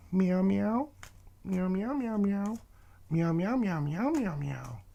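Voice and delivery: very high little kitty mews; playful cat meowing. Pretend. Reader meowing for the Leo kitty cats, high playful cat voice. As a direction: mew in quick runs like a kitten.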